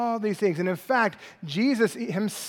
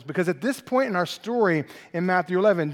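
usual speaking voice

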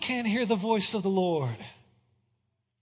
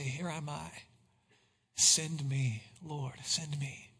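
low, hushed, halting surrender; effortful, summoning courage; 5 semitones lower, 9 dB quieter, slower than usual. Acted. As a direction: force it out softly, with great effort. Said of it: "took every ounce of strength and courage".

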